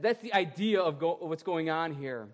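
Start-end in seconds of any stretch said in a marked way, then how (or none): none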